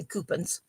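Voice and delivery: "coupons" said as KOO-puns; quirky family pronunciation. Shown as a demonstration. Host explaining her quirky word to new viewers, "coupons" as KOO-puns. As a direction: say "coupons" with a weak second syllable, KOO-puns. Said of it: "why I say coupons".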